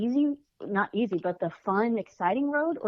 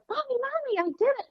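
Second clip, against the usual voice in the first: put-on much higher, thrilled little girl calling mommy; excited child; faster than usual. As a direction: burst out like a kid with big news. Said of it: "all excited and was like".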